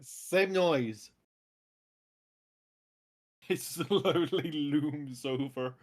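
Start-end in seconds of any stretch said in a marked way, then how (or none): 3.5-5.7 ominous narration. Acted.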